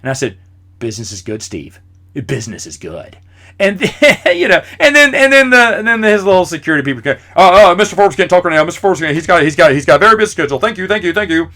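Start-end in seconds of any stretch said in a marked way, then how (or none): 0.8-1.8 lower, softer "Business is good, Steve"; deadpan reply to the billionaire; deadpan answer. Retold or imitated. Mock-serious reply to Forbes. 2.3-3.2 "Business is good" dropped much lower and softer; mock-deep repeat of his own answer. 7.4-11.5 louder, higher, brisk brush-off; imitating the security people brushing him off; hurried security aide. Security staff shooing him away.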